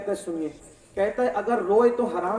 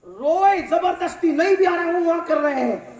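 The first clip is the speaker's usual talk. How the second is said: loud, high mocking outburst; mocking shout; 7 semitones higher, 6 dB louder than usual. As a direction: scorn the fake weepers at full voice. Mocking forced crying at gatherings.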